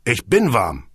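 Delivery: said through a cough. A narrator's reading. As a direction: cough out the words. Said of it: "erwiderte Hagen hustend".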